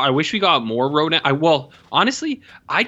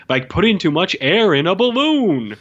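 higher, mock-explainer voice; Thomas Middleditch; 5 semitones higher, 4 dB louder than usual. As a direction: chime in with a hammed-up explanation. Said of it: "Thomas Middleditch will come in".

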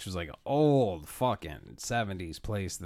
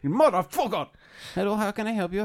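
put-on higher Cuban accent, curse then sweet; 10 semitones higher, 4 dB louder than usual. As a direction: flip from cursing to polite in a Cuban accent. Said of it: "in, like, a Cuban accent".

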